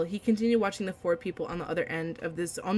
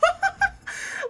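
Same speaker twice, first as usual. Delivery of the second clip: delighted laugh. Real, not acted. Reader reacting to character reveal.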